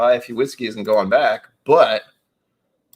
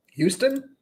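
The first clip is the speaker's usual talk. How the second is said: incredulous. Real, not acted. Surprised at Houston.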